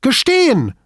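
a high, loud cry; cried out. A narrator's reading. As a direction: fling the word Gestehen out sharply. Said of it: "rief sie".

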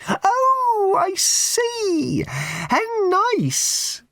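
very high, slow, sugary raffle-lady voice; a prim, simpering old charity lady pushing tickets; high twittering church-fete lady; raffle woman. Acted.

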